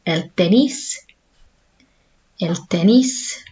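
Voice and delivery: tenis with a short e and a clear s, slowly; slow Spanish el tenis. Shown as a demonstration. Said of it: "we should be pronouncing as tenis".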